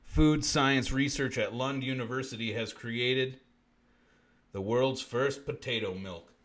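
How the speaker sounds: ordinary talk, nothing marked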